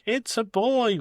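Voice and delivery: high mock joyful shout; a hint of an ecstatic new father; excited shout. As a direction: James calling out the news, high and excited. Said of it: "came running out of the room shouting".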